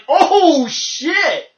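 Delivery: laughing alarm. Retold or imitated. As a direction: panic through a laugh. Han's reaction, laughing.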